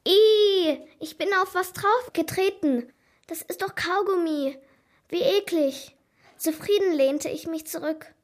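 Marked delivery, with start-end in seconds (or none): 0.1-6.0 high, loud squeal of disgust, then easing; grossed-out, higher-pitched complaint. A narrator's reading. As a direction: recoil from something sticky underfoot. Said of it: "verzog er sein Gesicht".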